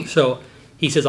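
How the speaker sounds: normal talking voice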